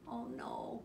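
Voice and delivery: soft, high, mild dismayed cry. A narrator's reading. Froggy finds nobody home, soft cry of dismay. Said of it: "cried Froggy".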